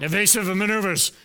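lightly voiced starship captain, quick commanding call; a hint of a brisk sci-fi captain giving orders; Captain Picard. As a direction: snap out the order like a captain on the bridge. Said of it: "you've gotta do a Captain Picard".

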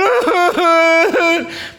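high, loud, teary laugh of joy; ecstatic laughing cry. Retold or imitated. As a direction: let out a joyful sobbing laugh. Friend's joyful outburst.

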